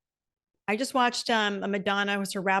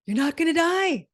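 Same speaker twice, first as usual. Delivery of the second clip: high, louder, falling hard on die; emphatic; 8 semitones higher, 5 dB louder than usual. Real, not acted. Podcaster urging listeners, high and emphatic. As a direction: hit it high and drop on die.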